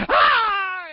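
put-on high pained scream of a little boy; child's scream. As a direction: scream like the antiseptic just hit the cut. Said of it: "she comes and".

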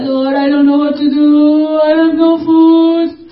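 hammed-up crying prayer to the Lord; mock crying plea. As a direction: wail it out like a helpless beggar. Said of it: "And we cry".

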